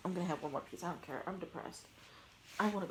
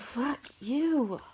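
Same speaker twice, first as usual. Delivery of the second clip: loud, raised curse with a drawn-out end; pissed off; 6 semitones higher, 9 dB louder than usual. Real, not acted. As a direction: curse the sneeze loudly and high. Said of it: "I'm getting pissed".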